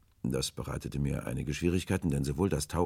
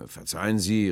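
raised, louder apology; calling apology; 5 semitones higher, 6 dB louder than usual. A narrator's reading. Will apologises to the stranger, calling out. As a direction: call out apologetically. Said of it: "rief Will".

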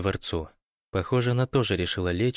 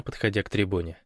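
ordinary delivery

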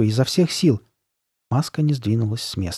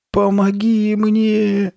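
high, drawn-out plea for help; slow, loud, high-pitched wail; thin frightened voice; 10 semitones higher, 5 dB louder, much slower than usual. A narrator's reading. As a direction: stretch it out in a thin, scared little voice. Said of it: "протянула Карли Бет тонким испуганным голоском".

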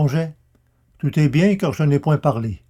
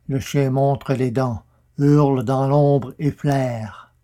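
usual speaking voice

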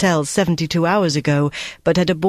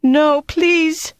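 much higher desperate plea; pleading cry; 12 semitones higher, 3 dB louder than usual. A narrator's reading. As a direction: beg in terror, voice climbing. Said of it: "she cried".

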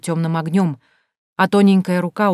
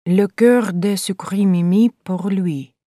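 slow French inscription reading; slower than usual. A narrator's reading. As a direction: read it out slowly in French. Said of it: "прочла я под изображением девушки". (медленно, с французским произношением)

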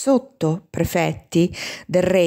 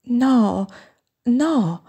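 higher, drawn-out trembling denial; mildly tremulous, pitched up; 5 semitones higher than usual. A narrator's reading. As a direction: let a slight tremble into the raised voice. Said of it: "ma con voce tremante".

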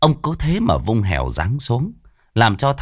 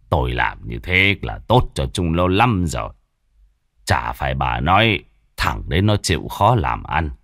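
put-on low, grave voice of an old rich man; old landlord laying down the law, lower; stern old father; 5 semitones lower than usual. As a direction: lecture the wife from above, gruffly. Father scolds wife.

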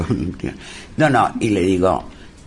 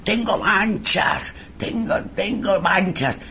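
anguished wailing priest; 6 semitones higher, slower than usual. Retold or imitated. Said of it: "Aldo gritando esas barbaridades".

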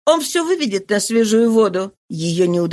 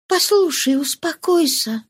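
gentle, slowed calming; soft soothing voice; 8 semitones higher than usual. Acted. Woman calms her weeping lover gently. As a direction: ease him down softly. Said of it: "сказала молодая женщина мягким голосом".

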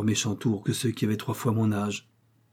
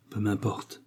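murmured to himself; 6 dB quieter, slower than usual. Acted. Brigand talking to himself, murmured softly. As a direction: mutter it to yourself, not to her. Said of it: "murmura-t-il en se parlant à lui-même".

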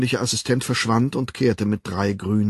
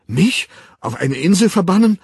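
entsetzt, hoch und laut hinausgerufen; panisch; 10 semitones higher, 9 dB louder than usual. A narrator's reading. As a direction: bricht in Panik aus. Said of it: "heftigen und ungehörigen Aktionismus". (panisch hoch und laut)

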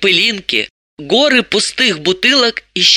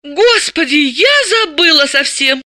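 high-pitched startled exclamation; flustered, high woman's cry; alarmed; 9 semitones higher than usual. A narrator's reading. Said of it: "испугалась Далила".

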